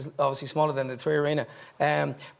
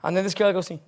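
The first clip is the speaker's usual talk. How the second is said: normal talking voice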